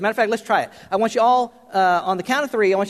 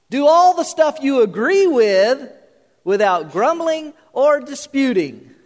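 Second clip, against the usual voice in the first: high, loud, exaggerated misquote; raised, sing-out mock proclamation; mock verse reading; 6 semitones higher, 5 dB louder than usual. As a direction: declaim it like a tongue-in-cheek scripture reading.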